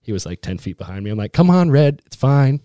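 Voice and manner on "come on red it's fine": louder, higher coaxing call; calling out encouragement. Retold or imitated. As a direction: urge on a friend lagging behind on a hike. Urging friend behind him on hike.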